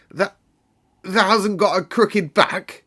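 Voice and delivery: put-on old Yorkshireman's hoarse wonder; hoarse old man. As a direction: stammer it out in disbelief. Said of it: "he said hoarsely".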